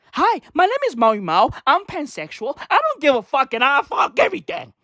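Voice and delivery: high, bright mock self-introduction. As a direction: announce it like a proud newcomer. Mock introduction as pansexual person.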